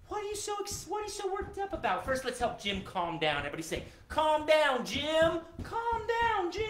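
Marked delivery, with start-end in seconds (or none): 0.1-2.0 high-pitched, baffled fussing at a dog; startled questioning. Pretend. Owner asking his barking dog what's wrong, high and startled. 4.1-6.6 high, sing-song group chant; calling out to soothe. Pretend. Entertainer leading kids to settle the dog, high calling.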